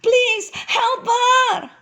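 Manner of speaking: slow, loud, very high wail; drawn-out desperate plea; anxious cry. A narrator's reading. Mother begging Moses for her child, anxious crying plea. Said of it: "cried the mother anxiously".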